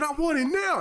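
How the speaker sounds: put-on high insistent kid voice; whiny child. Boy insisting to grandma, high whine. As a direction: demand it again like a spoiled boy. Child repeating his demand.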